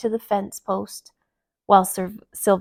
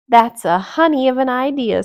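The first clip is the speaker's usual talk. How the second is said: Wendy voice; 3 semitones higher, 6 dB louder than usual. Acted.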